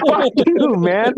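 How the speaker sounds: annoyed outburst. Retold or imitated. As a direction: snap back at a prankster. Angry at the tickling.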